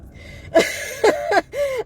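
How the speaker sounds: a sudden high-pitched giggle; high squealing laugh. Real, not acted. She laughs off being called crazy, high squealing giggle.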